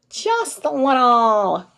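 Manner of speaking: slow, stretched goofy take on the words; goofy drawn-out. Shown as a demonstration. A tired teacher being goofy for the live chat, slow and silly. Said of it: "goofier version of myself today".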